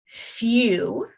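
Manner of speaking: "few" drawn out so the yoo is heard; long u in few. Shown as a demonstration. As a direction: stretch "few" to show the long U. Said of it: "say that so you can hear the U sound".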